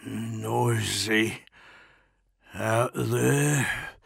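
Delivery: muttered, mouth full of blood; muttering through blood. A narrator's reading. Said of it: "Bronzy muttered through the blood".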